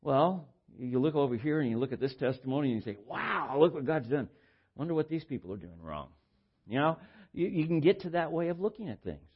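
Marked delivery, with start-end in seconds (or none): none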